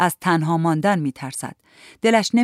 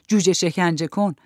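usual speaking voice

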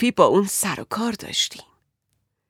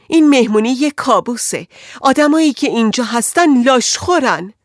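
agitated outburst; 5 semitones higher, 5 dB louder than usual. Acted.

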